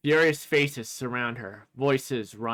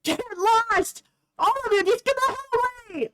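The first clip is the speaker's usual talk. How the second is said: high, loud, raging and close to tears; furious tearful girl; 4 dB louder than usual. Acted. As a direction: scream at the cruel fans to defend her idol. Reese drives fans off.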